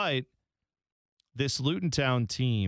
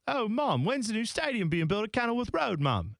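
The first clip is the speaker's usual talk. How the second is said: put-on high-pitched English child voice; five-year-old kid; 9 semitones higher than usual. Five-year-old telling mom about a new stadium, high child voice. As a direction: pipe up high like an excited little kid. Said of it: "like, a five-year-old back in".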